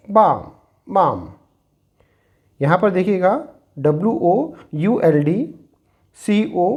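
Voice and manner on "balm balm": balm said as bam, no l; silent l demo. Shown as a demonstration. Teacher showing students the silent l in balm. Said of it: "This is".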